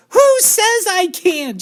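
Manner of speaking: high, loud, slow defiant yell; shout. A narrator's reading. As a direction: scream defiance like a spoilt brat. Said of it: "shouted Veruca".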